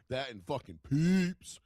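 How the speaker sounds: loud, drawn-out candy excitement; hammed-up snack lust; exaggerated relish. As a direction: savor it loudly, stretching the words. Gleeful reaction to candy display.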